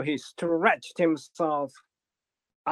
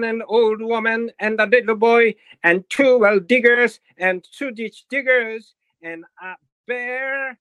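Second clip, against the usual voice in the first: loud, high bragging; boastful; 5 semitones higher, 6 dB louder than usual. Acted. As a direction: brag it out proudly, louder on each item. Boastful Johnny Cake.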